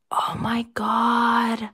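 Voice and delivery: a real, slow, breathless whisper; awed whisper. Real, not acted. Staring at the Charizard card, real stunned whisper. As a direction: breathe it out, barely voiced, in awe.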